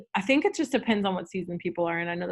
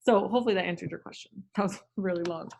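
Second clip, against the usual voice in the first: ordinary talk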